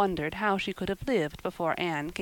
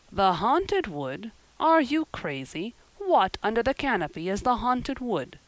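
incredulous; 5 semitones higher, 4 dB louder than usual. A narrator's reading. Marilla staring at Anne over the haunted wood, incredulous. Marilla astonished at Anne's refusal.

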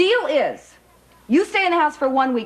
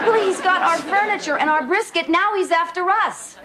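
urgent warning; 6 semitones higher than usual. Acted. Wife warns her husband urgently.

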